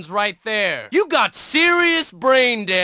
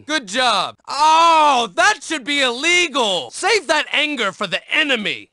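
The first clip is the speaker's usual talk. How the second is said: normal talking voice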